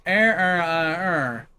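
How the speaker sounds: "Er, er, uh, er" as near-identical drawn-out r sounds; accent-swallowed vowels. Retold or imitated. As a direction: blur "Aaron earned an iron urn" down to "Er, er, uh, er". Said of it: "Do we really sound like that".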